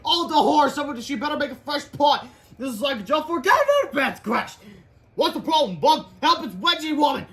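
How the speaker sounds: high, loud hammed-up alarm; mock panic. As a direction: cry out in cartoon terror. Panicked comic characters.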